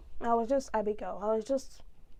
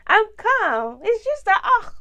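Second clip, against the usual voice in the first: raised, louder protest of being calm; exasperated insistence; 9 semitones higher, 8 dB louder, slower than usual. Real, not acted. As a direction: insist loudly as composure slips, pitch up. Calm claim breaks into exasperation.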